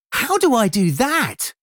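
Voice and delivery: keen, high question; eager. A narrator's reading. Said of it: "asked Harmony eagerly".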